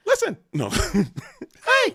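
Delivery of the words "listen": very high, loud call of listen. Pretend. Co-caster mimics the counselor hushing the group, very high call.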